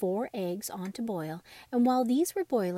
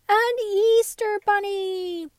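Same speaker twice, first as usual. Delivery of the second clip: much higher, louder and slow, a squeal of joy; squealing delight; 12 semitones higher, 11 dB louder, much slower than usual. Acted. Dolls cheering the Easter bunny, squealing with joy. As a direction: stretch it out in a high squeal.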